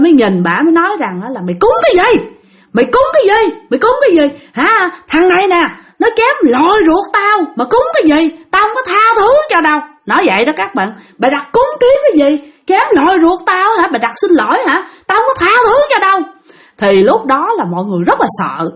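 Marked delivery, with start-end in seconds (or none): 1.5-9.8 high-pitched angry spirit voice, far above normal; acted shrill outburst of a possessing spirit; possessed man yelling. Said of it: "cái người con trai lớn đó mới la lên". 11.2-16.2 high-pitched angry spirit voice, far above normal; acted shrill outburst of a possessing spirit; possessed man yelling. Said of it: "mới la lên".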